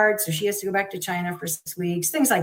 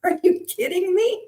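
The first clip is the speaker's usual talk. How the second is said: incredulous; 12 semitones higher than usual. Retold or imitated. Mom's disbelief at diagnosis delay.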